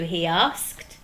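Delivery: plain reading voice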